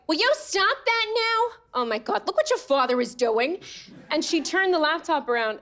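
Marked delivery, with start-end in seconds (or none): 0.1-3.6 imitated Staten Island mother, mid-argument; exasperated mom in a Staten Island accent; Italian-American mom. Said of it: "My parents sound just like that".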